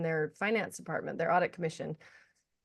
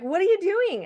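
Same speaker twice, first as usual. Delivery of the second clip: high, loud imitation of the friend's question; British friend's surprise; 11 semitones higher, 6 dB louder than usual. British friend bumping into her at Heathrow, imitated surprise. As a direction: mimic a friend's excited greeting, pitched way up. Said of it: "And she's British".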